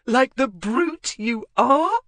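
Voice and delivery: high, slow, loud mockery; mocking. Wife mocking her husband, high and slow. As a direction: sneer it out slowly. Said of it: "Mocking laughter now".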